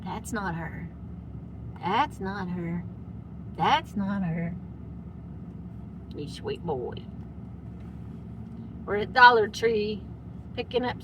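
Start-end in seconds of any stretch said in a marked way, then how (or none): 0.0-4.6 soft, lower voiced dog's worry; voicing the dog. Voicing the dog missing mom, soft lower mock dog. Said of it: "he's like". 6.2-7.0 tender. Real, not acted. Tender to dog.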